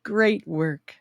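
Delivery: ordinary talk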